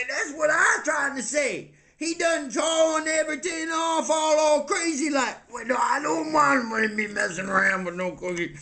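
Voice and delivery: high, worked-up grumbling; agitated complaining character. Acted. Castmate complaining Cookie acts all crazy, agitated rant.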